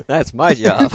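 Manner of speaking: said through a laugh. Real, not acted. Podcaster teasing co-host over his job, laughing. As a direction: giggle out the protest. Laughing while claiming the role.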